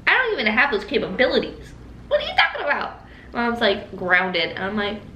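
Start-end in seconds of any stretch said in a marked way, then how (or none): 0.1-3.0 mock-baffled denial, jumping high in pitch; indignant protest. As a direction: deny it in squeaky disbelief. Retold protest against sister's accusation.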